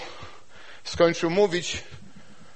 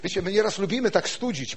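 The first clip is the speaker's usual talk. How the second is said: ordinary talk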